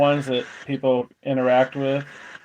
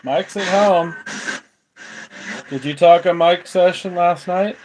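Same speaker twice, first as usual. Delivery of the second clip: to someone hard of hearing; 4 semitones higher, 4 dB louder than usual. Real, not acted. Answering spirit box loudly.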